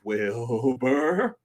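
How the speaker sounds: drawn-out horse-like call; mock horse voice, sliding upward; talking-horse impression. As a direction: stretch the name out like a talking horse. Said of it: "that always brings me back to".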